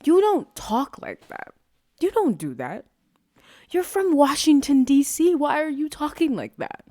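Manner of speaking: loud, high incredulous telling-off; play-scold someone, voice jumping up high; exasperated comic confrontation; mock indignation. Mock confrontation of absent celebrity.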